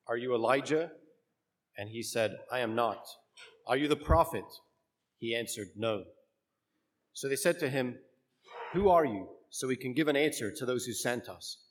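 measured liturgical proclamation; solemn church reading voice; gospel reading. Acted.

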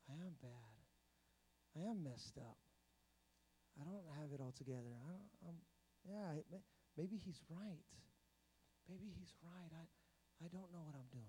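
quietly imitating someone who feels lost; defeated self-doubt. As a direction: let it sink low and small, as if giving up. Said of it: "And a lot of times we go".

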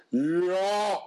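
imitating a classmate's hammed-up booming Yahweh; mock deep voice. Schoolboy pranking classrooms down the hall, mock deep Yahweh. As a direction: ring out Yahweh like a voice from above. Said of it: "he had a super deep voice".

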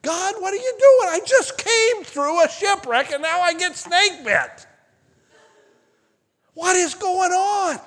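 hammed-up crying out to God; mock panicked complaint. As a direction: pretend despair after snakebite. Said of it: "I would have probably went".

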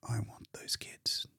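a man whispering his wish; quiet. Acted. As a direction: barely voice it, a hushed plea. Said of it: "said Welling quietly".

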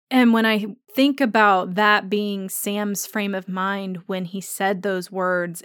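usual speaking voice

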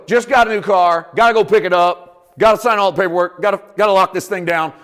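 excited new car owner excuse; eager excuse. Pretend. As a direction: rattle off the errands, pumped up. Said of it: "You go".

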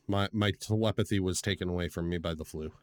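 usual speaking voice

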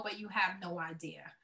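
usual speaking voice

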